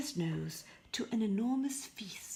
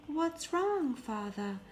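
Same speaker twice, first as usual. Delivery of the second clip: higher, concerned daughter; Beauty worried; 8 semitones higher, 3 dB louder, slower than usual. Acted. Beauty asks her pale father what is wrong, higher voice. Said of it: "Beauty wanted to know".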